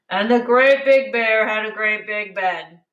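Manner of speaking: a hint of a big lumbering bear; lightly voiced big papa bear. A narrator's reading.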